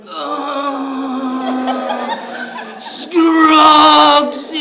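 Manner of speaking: high, loud, sung-out wail; dramatic drunken wail. Retold or imitated. Drunk friend crawling from his bedroom, hammed-up loud sung wail. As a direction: moan the name like a dying man. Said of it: "crawling out ever so dramatically".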